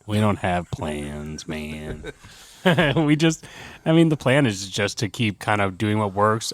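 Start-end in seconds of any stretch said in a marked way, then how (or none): 0.0-2.1 slow, low deadpan shrug. Real, not acted. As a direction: drawl it, unbothered. Deadpan admission.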